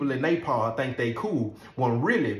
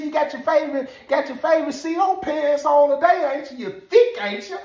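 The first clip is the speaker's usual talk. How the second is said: high, loud mocking jab; teasing inmate; 12 semitones higher, 6 dB louder than usual. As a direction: needle the guard loudly. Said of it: "He said".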